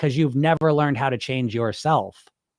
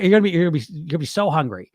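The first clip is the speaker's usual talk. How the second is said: usual speaking voice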